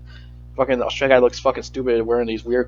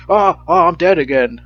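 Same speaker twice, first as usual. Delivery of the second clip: higher, louder mock cry of agony; mock dying cry; 6 semitones higher, 5 dB louder than usual. Reviewer mocking the guy burned by a flamethrower, mock dying cry. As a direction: playfully overact being burned up.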